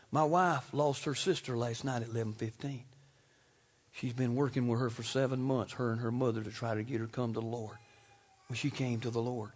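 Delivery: low, soft, subdued telling; solemn testimony. Real, not acted. Preacher telling of wife's sister's death last night, solemn. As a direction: share the loss quietly and soberly.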